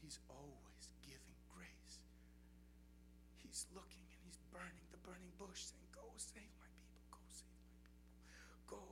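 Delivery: soft whispered preaching; reverent whisper. Real, not acted. Preacher whispering God's call to the congregation. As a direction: hush it like a secret.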